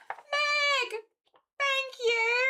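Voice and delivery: very high and loud delighted cry; excited squeal. Real, not acted. Streamer thanks a viewer for a gift, squealing with delight. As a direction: squeal their name in pure joy.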